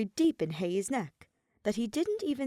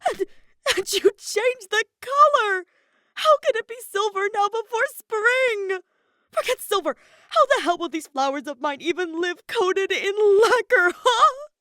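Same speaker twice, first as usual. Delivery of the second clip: much higher, louder wailing complaint; bawling, outraged; 10 dB louder than usual. A narrator's reading. Kaveh wailing at his husband about the silver roses. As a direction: sob it out at the top of the voice. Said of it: "started bawling his eyes off".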